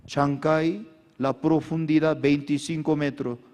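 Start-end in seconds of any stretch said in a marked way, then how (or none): none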